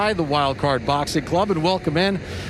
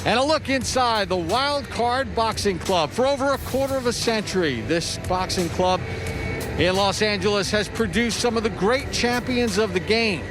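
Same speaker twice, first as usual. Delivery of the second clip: higher, upbeat broadcast opener; sports announcer; 4 semitones higher than usual. Acted. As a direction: welcome viewers to the big show. Broadcast open by host.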